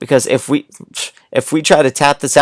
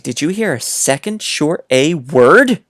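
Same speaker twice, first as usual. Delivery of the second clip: ordinary talk, nothing marked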